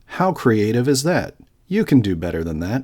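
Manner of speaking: lightly acted viewer heckle, lifted pitch on each wow; mock unimpressed viewers. Host voices viewers doubting the video's creativity, mock unimpressed. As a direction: imitate sceptical commenters, punchy and slightly exaggerated. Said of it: "some of you may have been saying".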